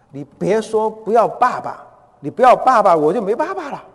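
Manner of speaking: high, loud imitation of a begging child; tearful pleading. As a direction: act out a daughter imploring her mother. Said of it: "哀求他的妈妈说".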